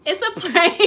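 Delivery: loud, high, bursting with laughter; gleeful shout, cracking up; laughing reveal. Real, not acted. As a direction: blurt out the prank through laughter.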